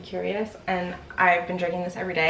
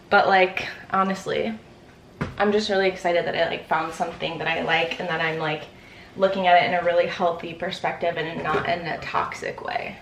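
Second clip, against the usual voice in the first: normal talking voice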